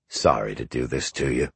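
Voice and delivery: low, soft, faintly smug apology; an insincere sorry from the American to Boris; pleased-sounding apology. A narrator's reading. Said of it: "though he didn't sound sorry".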